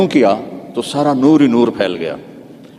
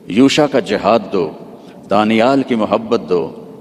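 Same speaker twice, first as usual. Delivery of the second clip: strange heavenly voice. Retold or imitated. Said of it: "ایک عجب آواز آئی".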